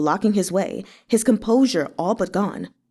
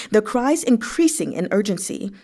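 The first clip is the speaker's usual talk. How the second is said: plain reading voice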